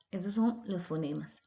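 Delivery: normal talking voice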